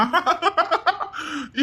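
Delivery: high, crowing villain laugh; gloating laugh. Acted. Schemer gloats to accomplice, villainous laugh. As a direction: cackle over the catch.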